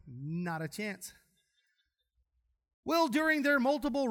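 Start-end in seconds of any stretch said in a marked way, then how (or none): 0.1-1.1 much softer, lower, very slow refusal; drawn-out "no way"; flat refusal. Retold or imitated. Preacher refusing the zipper ride, low slow dismissal. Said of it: "I'm on the ground going".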